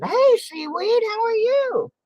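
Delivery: high, bright hello to a viewer; chirpy greeting. Real, not acted. To a viewer who just joined, a high cheery greeting. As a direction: call out a cheery hi.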